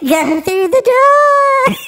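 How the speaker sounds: high, slow, eerie wail; Poltergeist ghost voice. Retold or imitated. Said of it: "what's the movie Poltergeist?".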